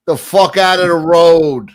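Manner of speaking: a loud, slow mock yell at a turkey; imitating a New York yell. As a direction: bark it like a New Yorker stuck in traffic. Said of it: "a guy screaming at the turkey".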